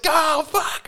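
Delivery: high yelp of mock panic; sudden high mock alarm; player's dismay. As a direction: squeak it out as doom dawns. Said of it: "And they're like".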